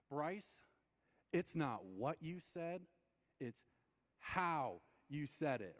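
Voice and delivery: slow, deliberate stress on "how"; pause, then draw out "how"; pointed correction. Retold or imitated. Her telling Bryce off over his tone, pointed correction. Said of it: "And she says this".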